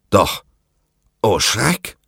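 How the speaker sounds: ordinary delivery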